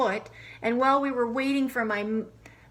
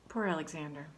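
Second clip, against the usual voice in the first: sympathetic sigh; 5 semitones lower, 8 dB quieter, faster than usual. Acted. Sympathetic aside.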